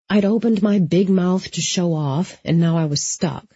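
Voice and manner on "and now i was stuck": ordinary delivery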